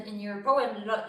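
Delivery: usual speaking voice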